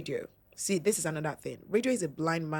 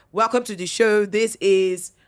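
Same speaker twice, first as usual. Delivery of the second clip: loud, punchy Nigerian presenter welcome; Nigerian radio intro; 2 semitones higher, 8 dB louder than usual. Shown as a demonstration. Host opens a show as Nigerian as possible, loud radio intro. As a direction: announce the station proudly Nigerian and loud. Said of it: "speak as Nigerian as possible".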